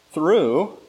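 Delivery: "through" lifted high and held; emphasis on through. Shown as a demonstration. Preacher using inflection on the repeated word, "through" stressed. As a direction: hit "through" hard, then read on. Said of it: "to emphasize a word".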